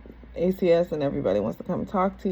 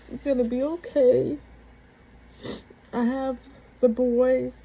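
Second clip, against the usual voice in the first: sister's voice pitched higher, relieved and urgent; 6 semitones higher, slower than usual. Retold or imitated. Sister at the hospital bedside to her waking sibling, higher and relieved. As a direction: reassure her that her husband is still alive.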